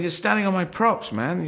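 pitched high, mock indignant; a put-upon performer, voice jumping up; exasperated complaint. Street performer complaining to someone standing on his props, mock indignant. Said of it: "And you're saying".

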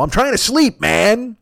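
high-pitched griping imitation; mock whiny complaint. Woman woken by the phone call, mock whiny complaint.

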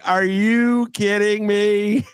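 slow, high, disbelieving; incredulous. Retold or imitated. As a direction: stretch it out in disbelief. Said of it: "I am like".

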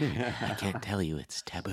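soft, secretive hush; whisper. Shown as a demonstration. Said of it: "what are you gonna whisper".

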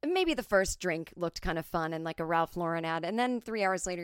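ordinary talk

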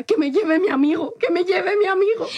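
high, half-laughing, half-crying plea; drunk tearful plea. Retold or imitated. Begging the staff to let her friend push, tearful and laughing. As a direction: beg through giggling sobs. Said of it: "Y yo le decía".

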